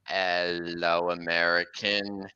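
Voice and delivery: very low, slow monster greeting; killer train car's catchphrase. Acted. Possessed car greeting any victim, low creature voice. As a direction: draw it out deep and low. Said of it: "he would say".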